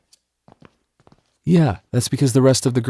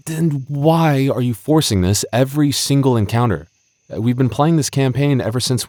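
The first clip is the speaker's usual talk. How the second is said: pitched-up frustrated questions; exasperated questioning; 5 semitones higher than usual. Real, not acted. DM confronting the bard player, exasperated and pitched up. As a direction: demand an answer, fed up.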